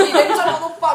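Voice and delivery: mock bawling at her husband; retold crying. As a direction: re-enact a weepy accusation. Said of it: "하면서 울면서".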